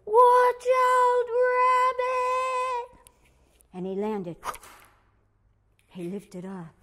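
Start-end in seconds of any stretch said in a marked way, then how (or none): 0.0-3.0 high, loud shouted warning. Acted. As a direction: yell it out high. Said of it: "the animals were running".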